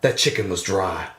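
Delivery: very low, deadpan parting shot; noir detective. Retold or imitated. Detective turning back with a last jab, low deadpan. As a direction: drop deep and understate it, pausing between phrases. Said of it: "Like, the detective walks out".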